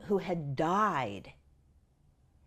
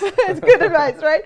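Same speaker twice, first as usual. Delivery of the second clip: high, loud laugh through the words; real laughter; 11 semitones higher, 14 dB louder than usual. Real, not acted. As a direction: laugh it out at your own joke. Laughing at her own story.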